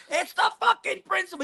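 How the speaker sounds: high, strained mock yell; yelling to himself. Crackhead throwing his money everywhere, shrieking high and angry. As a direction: play the raging drunk shrieking at nobody. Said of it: "He's yelling this".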